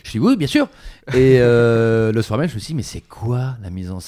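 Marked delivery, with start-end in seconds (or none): 0.2-0.7 high, loud and eager acceptance; eager yes. Retold or imitated. Accepting the director's call, eager and loud. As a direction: jump at the offer out loud.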